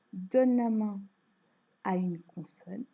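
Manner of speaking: ordinary talk, nothing marked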